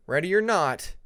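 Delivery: ordinary delivery, nothing marked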